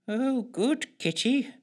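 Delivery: high, slow, soft purr; purring cat person. A narrator's reading. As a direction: coo softly to the cat. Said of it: "she purred".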